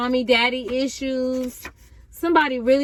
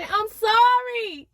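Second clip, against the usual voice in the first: high, slow drawn-out sorry; pleading sorry; mock apology; 9 semitones higher, much slower than usual. Acted apology.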